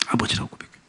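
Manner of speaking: hushed whispered echo. Shown as a demonstration. Pastor whispers the confession back to the congregation. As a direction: breathe it out almost voiceless. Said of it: "repeated softly as echo".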